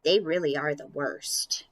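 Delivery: deadpan it like the mom; mom's voice. Acted. Mom agreeing with Lucy about pets, dry and low. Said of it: "And Mom said".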